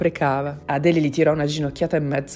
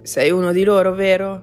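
louder, confrontational question; accusing, raised voice; loud accusation; 2 semitones higher, 6 dB louder than usual. A narrator's reading. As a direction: challenge the intruder loudly. Woman confronts the intruder in a fight.

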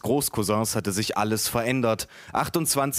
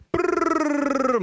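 very high rolled brrr; sustained high-pitched engine trill; airplane noise; 3 dB louder than usual. A narrator's reading. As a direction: buzz like a toy plane for a baby. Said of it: "machte meine Tante".

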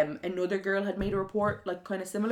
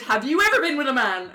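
higher, louder mock question through laughter; 6 semitones higher, 8 dB louder than usual. Turning the driver's question back on him, mocking and laughing. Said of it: "So I went".

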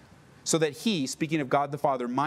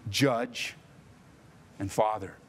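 solemn sermon close; 5 semitones lower, 4 dB quieter than usual. Acted. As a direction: ease down into the benediction. Closing over music.

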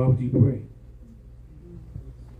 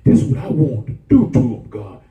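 angry plea to God; 4 semitones higher, 7 dB louder than usual. Retold or imitated. Preacher voicing his angry plea.

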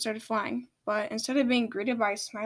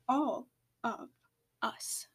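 whispered; 8 dB quieter, slower than usual. Acted. Queen quietly insists.

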